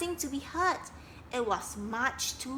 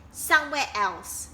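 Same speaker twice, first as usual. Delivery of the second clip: louder curt snap; snapped; 3 semitones higher, 6 dB louder than usual. Acted. Duck dismissing sad Kingfisher, snapping. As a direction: cut him off sharply. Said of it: "snapped Duck".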